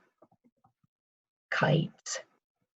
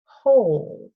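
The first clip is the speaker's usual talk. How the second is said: normal talking voice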